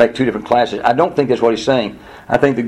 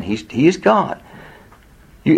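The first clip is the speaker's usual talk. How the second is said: normal talking voice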